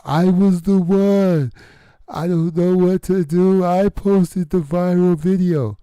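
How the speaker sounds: high, loud mock fake-cry voice; imitating a showy fake cry. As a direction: parody her put-on crying, pitched high and loud. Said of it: "put on a fake cry and talk out loud".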